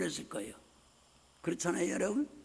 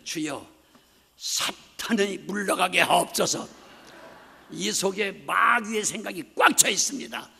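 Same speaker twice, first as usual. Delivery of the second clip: fervent prayer; 6 dB louder than usual. Retold or imitated. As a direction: cry out to God for deliverance. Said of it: "retold prayer".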